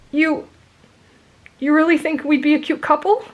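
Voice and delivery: sheepish. A narrator's reading. Boy asks his friend about being a couple, sheepish. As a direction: ask shyly with hesitant hope. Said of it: "he asked sheepishly".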